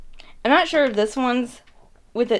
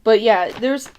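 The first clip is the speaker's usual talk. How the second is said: ordinary talk, nothing marked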